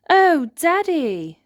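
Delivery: high, loud and slow cry of dismay; shocked gasp. Acted. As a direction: gasp as if the worst just happened. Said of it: "gasps Holly".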